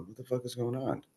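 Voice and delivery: retold hushed, puzzled aside; bewildered aside. Puzzled fighter to himself as police run over, hushed aside.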